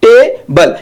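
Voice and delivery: Table in two beats, TE, then BUL; split into syllables. Shown as a demonstration. Teacher showing students the two mouth shapes, split into syllables. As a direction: break table into two separate, drawn-out syllables. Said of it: "आकार दो बार change होता है".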